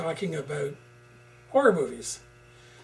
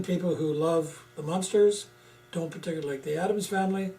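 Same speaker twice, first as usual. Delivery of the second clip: ordinary delivery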